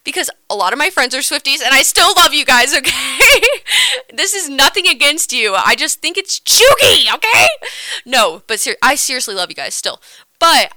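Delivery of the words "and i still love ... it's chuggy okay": high, loud, laughing insistence; laughing shout. Real, not acted. Loud laughing defense to friends.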